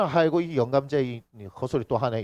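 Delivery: low, quiet, fed-up grumble under the breath; a low, annoyed aside; grumbling older brother. Retold or imitated. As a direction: mutter it like a bored son sick of the old man's stories. Older son grumbling at grandfather.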